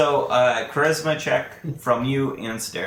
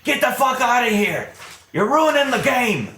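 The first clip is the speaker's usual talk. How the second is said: angry yell; 11 semitones higher, 5 dB louder than usual. Acted. Staravash yelling guards away.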